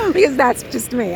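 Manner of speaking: ordinary delivery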